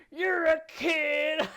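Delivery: put-on loud, drawn-out shout at a kid; yelling. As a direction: bellow it down at her. Adults yelling at the girl.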